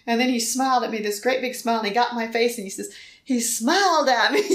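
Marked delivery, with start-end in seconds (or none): 3.2-4.4 giggling through the words; laughing quote. Retold or imitated. Wife laughing while retelling what husband said. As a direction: laugh while quoting him.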